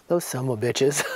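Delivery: low, mock cursing in a man's voice. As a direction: drop low for a comic imitation of his swearing. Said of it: "He loved to swear".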